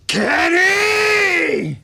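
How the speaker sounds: long, high-pitched furious-sounding scream; screamed name. Acted. Levi stares down his enemy and screams out, long high scream. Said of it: "screams out".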